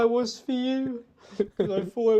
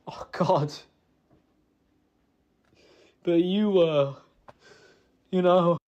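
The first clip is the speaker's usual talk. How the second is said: emotional; 3 semitones lower than usual. Real, not acted. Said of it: "I'm sorry for getting emotional".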